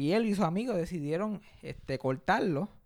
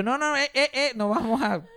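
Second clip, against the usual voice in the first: loud, higher objection, stumbling over the no's; urgent protest; 8 semitones higher, 6 dB louder than usual. Retold or imitated. Kid stopping friends from a rock game, urgent protest. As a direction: cut in to stop the game loudly.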